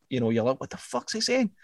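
ordinary delivery

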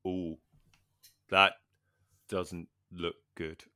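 soft, slow "ooh, that doesn't look good"; quiet dismay. Retold or imitated. Watching his arm shake, softly and slowly dismayed. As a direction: murmur it as the arm wobbles. Arm shaking under weight.

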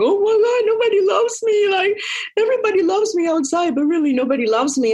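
high, whiny imitation of sobbing self-pity; mock self-pity wail. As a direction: wail it like a hammed-up heartbroken victim. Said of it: "on my couch crying and going".

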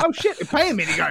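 surprised realisation. Retold or imitated. Retold realisation.

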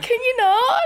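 high-pitched mock protest; imitating exasperated parents. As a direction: plead it high like fed-up parents. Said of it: "And her parents are like".

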